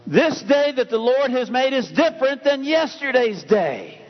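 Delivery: full-force sermon climax; preaching at full force. Acted. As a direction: proclaim it to the congregation.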